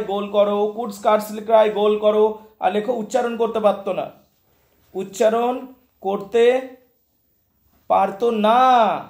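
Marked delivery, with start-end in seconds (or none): 4.9-9.0 spaced out slowly, phrase by phrase; slow dictation. Real, not acted. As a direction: dictate it slowly with long pauses. Dictating words to note down. (লেখানোর জন্য ধীরে ধীরে, থেমে থেমে)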